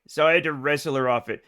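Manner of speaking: usual speaking voice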